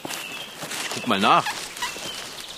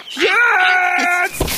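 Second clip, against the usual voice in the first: high shout on the leap; jumping shout; 13 semitones higher, 4 dB louder than usual. Acted. Cat pouncing on the bunny, shouting high. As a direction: yell it as you pounce. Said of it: "Ich springe, ich springe".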